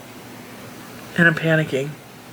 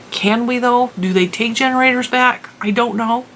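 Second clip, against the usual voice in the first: panicky disbelief; 6 semitones higher than usual. Retold or imitated. Retelling her own panicked reply.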